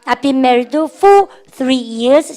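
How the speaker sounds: slow classroom repeat-after-me; very slow drill reading. Shown as a demonstration. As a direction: say it slowly so students can follow. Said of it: "시작".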